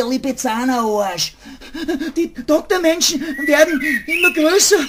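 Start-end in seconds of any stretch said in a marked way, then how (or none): 2.2-4.8 higher, rising alarm at the looming doctors. Acted. Rabbit watches the doctors come closer in alarm. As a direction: let the fear climb.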